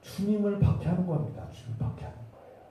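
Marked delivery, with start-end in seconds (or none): 1.5-2.6 whispered, much softer; hushed repetition. Real, not acted. Preacher driving home persecution of the Lord, hushed whisper. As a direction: drop to a grave whisper. Same point repeated in a hush.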